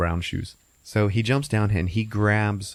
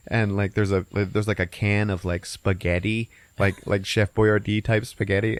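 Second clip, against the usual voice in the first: ordinary talk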